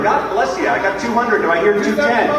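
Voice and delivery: auctioneer calling bids. Acted. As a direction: run the room like a charity auctioneer.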